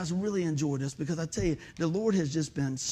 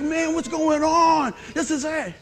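imitating excited son; 7 semitones higher, 5 dB louder, slower than usual. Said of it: "He's like".

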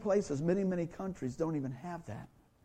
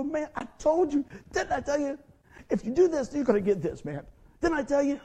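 scolding father; 8 semitones higher, 4 dB louder than usual. Retold or imitated.

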